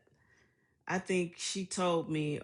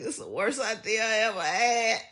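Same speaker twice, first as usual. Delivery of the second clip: higher, louder mock melodrama; dramatic old mother; 7 semitones higher, 6 dB louder than usual. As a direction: ham up the old mother's despair. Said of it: "She was so dramatic".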